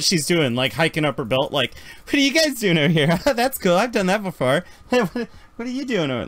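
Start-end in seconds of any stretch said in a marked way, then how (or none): 2.0-6.3 high, fast, eager chatter; super interested. Retold or imitated. Sarah nosing around the crew, high eager chatter. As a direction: bounce in, thrilled by everything. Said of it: "she's super mega interested now".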